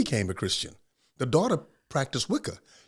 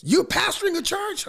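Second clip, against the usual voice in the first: much higher, louder amazed question; incredulous neighbor; 3 dB louder than usual. Retold or imitated. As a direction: ask it like you can't believe a preacher drank. Neighbor surprised again.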